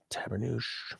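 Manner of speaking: soft whispered Québécois curse; whispered Québécois oath. Real, not acted. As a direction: breathe it out as a quiet oath. Whispered exclamation.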